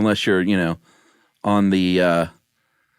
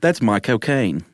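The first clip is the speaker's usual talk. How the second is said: slow Cockney Michael Caine drawl on cocaine; Michael Caine accent. Shown as a demonstration. Showing the host the right Michael Caine accent, slow Cockney. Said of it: "Michael Caine".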